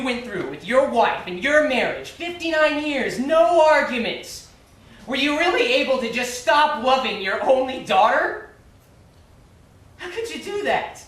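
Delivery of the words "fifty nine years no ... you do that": demanding an answer from Mister Green; pleading anger. Real, not acted. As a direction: press him hard, voice rising. Pressing Mister Green about daughter.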